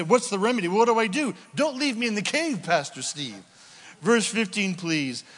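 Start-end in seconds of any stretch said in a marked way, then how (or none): 0.0-3.4 high, loud pleading questions; mock congregation plea. Congregant begging the pastor for help, mock plea. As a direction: beg the pastor like a stuck listener. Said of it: "we gotta say".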